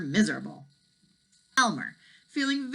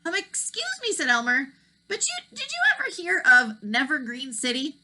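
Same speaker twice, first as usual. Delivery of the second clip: put-on boy voice; 10 semitones higher, 4 dB louder than usual. A narrator's reading. Elmer asks gull.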